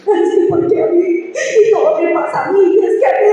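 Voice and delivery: high whiny "why me"; hammed-up whimpering complaint; mock self-pity. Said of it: "Quejándose ahí cuando nos quejamos".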